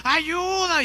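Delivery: high desperate cry for help, far off; shouting for help. Retold or imitated. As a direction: call for help from the bottom of a cliff. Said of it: "comenzó a gritar con todas sus fuerzas". (gritando auxilio desde el precipicio)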